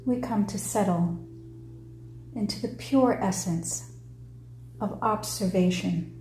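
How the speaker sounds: measured meditation-leader voice, falling phrase ends; guided meditation. Acted. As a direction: lead them through it in short phrases with long pauses.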